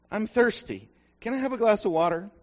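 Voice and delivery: put-on small boy begging his mother for water; child's plea. Child asking again.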